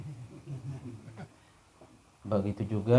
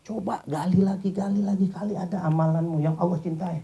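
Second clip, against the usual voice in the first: urging sheikh; 8 semitones higher, 3 dB louder than usual. Acted. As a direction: press him gently but eagerly. Sheikh urging the man to remember. (syekh membujuk dengan nada tinggi)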